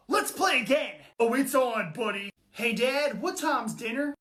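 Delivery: normal talking voice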